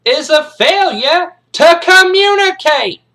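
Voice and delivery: drill-instructor tirade, high and very loud; slow, hammered shouting at new cadets; Major Payne. Acted. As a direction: bellow every word slowly to assert authority. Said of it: "He's like".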